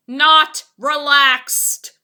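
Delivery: slow, loud insistent cry; cried out. A narrator's reading. Poppleton crying to Fillmore in the rocking boat, slow and loud. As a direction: drag it out in alarm. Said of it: "Poppleton cried".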